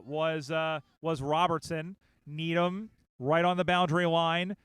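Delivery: normal talking voice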